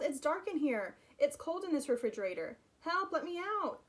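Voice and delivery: high, loud plea; trapped and cold, calling through the door. Acted. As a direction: keep calling for someone to hear. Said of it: "he called".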